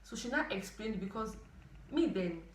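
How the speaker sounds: usual speaking voice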